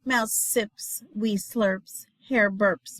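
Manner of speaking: plain reading voice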